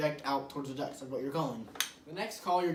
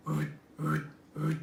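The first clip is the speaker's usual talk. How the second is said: caveman grunt. Shown as a demonstration. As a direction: grunt it from the diaphragm. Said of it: "It sounds like a caveman".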